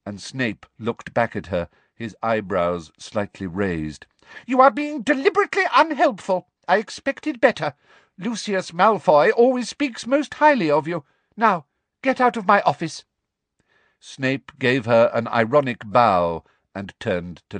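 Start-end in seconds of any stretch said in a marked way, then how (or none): 4.5-13.0 much higher, louder, fast and scolding; an outraged dismissal; furious Umbridge. A narrator's reading. Umbridge orders Snape out of her office, furious.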